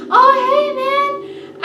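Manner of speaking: high, loud sing-song "oh hey man"; mock party-bro whoop. Reviewer imitating a carefree guy yelling to his friend, high and loud. Quoting a reckless guy in film.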